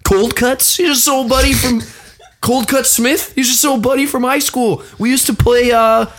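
high, cornered and backpedalling; husband caught out by his wife, high and nervous; [name]'s high voice. Acted. [name] reacts to name Max.

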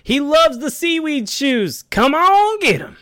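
high, loud and slow sales hype; hyped ad closer. Acted. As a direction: shout the pitch to the crowd.

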